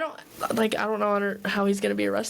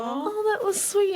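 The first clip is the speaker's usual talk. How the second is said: touched; 11 semitones higher than usual. Retold or imitated. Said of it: "And I'm like".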